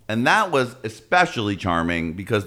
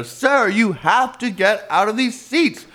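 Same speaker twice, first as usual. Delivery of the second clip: higher, slower mock usher; pleading usher, higher; 9 semitones higher, slower than usual. Usher asking the man in the seats where he came from, pitched up. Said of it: "and they're".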